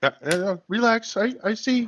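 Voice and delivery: high, loud "I get it already"; loud brush-off. Real, not acted. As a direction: loudly tell them to back off. Brushing off friends calling him.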